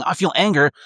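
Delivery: imitating a rash angry reaction; hothead reacting. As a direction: climb in pitch like anger boiling over. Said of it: "That's not being".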